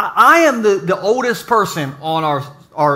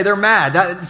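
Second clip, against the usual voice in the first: ordinary delivery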